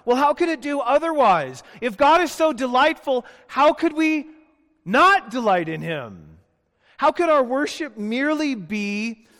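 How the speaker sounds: high, loud, rising sermon climax; pleading with the congregation, loud and high; impassioned preaching. Acted. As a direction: preach it with mounting fire. Preaches at full force.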